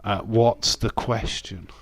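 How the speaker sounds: ordinary talk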